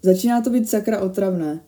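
ordinary delivery

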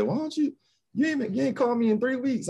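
high, mock-complaining mother's voice; mock mom voice. Son imitating his mom scolding him for not calling, high mock voice. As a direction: pitch it way up like an annoyed mom.

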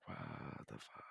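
whispered, stunned disbelief; mother's shocked whisper at the bad news. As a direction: breathe it out in quiet horror. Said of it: "the look in her face when she was just like".

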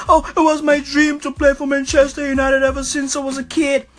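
fast mocking whiny voice; mock the player's dreamy excuse; mocking quote. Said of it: "Hey, Alexis, what happened?".

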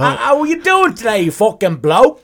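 hammed-up British bloke; a cheeky British bloke greeting his mate; mock British accent. Host teases his guest in a mock British accent. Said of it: "I'm not sure if I'm Australian or British".

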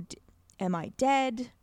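ordinary talk, nothing marked

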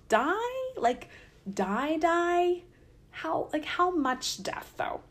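high, soft, hesitant queries; mock-uncertain, with pauses between words; skeptical questioning. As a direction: probe it haltingly, pitch climbing. Acting doubtful questioning of death threat.

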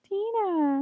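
put-on high, drawn-out little-girl call; mock child calling out; imitating the girl. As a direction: stretch the name out high, like a child. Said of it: "and then she's like".

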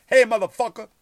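loud, high yell of hey motherfucker; screaming. Retold or imitated. Homeless man screaming at comedian on the street. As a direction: bellow it at a stranger. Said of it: "They scream at you".

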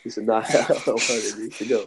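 repeats the line through a real laugh; laughing at his own bad luck. Real, not acted. Retelling his plan to a friend, laughing.

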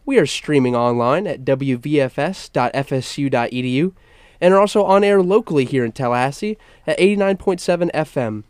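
normal talking voice

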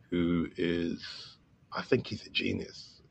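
softly, as if not to jinx it; hushed confiding. Real, not acted. Filmmaker praising musician without jinxing it, hushed. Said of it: "I'm whispering it".

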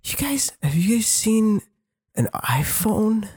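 mock drunk guy. Drunk guy asking strangers about a lost phone, mock slurred. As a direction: ask around like a drunk guy. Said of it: "he's like".

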